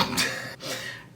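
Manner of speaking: quiet, voiceless chuckle; soft laugh. Real, not acted. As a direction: laugh under your breath. Laughs after telling the ending.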